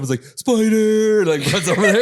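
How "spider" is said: high, loud cartoon alarm cry; drawn-out high holler, played for laughs; mock panicked shout. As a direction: yell it like a startled cartoon character. Acts out cartoon character waking in panic.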